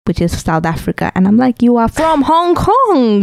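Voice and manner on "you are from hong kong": high, slow, incredulous protest; mock exasperation. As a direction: draw it out in high-pitched mock outrage. Mock outburst at a friend.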